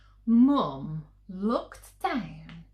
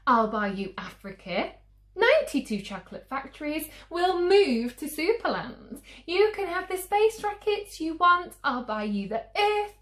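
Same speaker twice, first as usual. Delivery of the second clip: much higher, louder and fast, piling it on; desperate mum; 9 semitones higher, 7 dB louder, faster than usual. Acted. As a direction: promise the whole world in one breath. Mum's desperate endless bribe.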